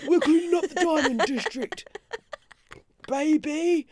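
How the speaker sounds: high, mock-heroic proclamation. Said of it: "someone from Hatton Garden's going".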